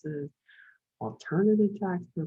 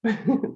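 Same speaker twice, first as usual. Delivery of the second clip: nervous laugh; 5 semitones higher, 4 dB louder than usual. Real, not acted. Nervous laugh at being called expert.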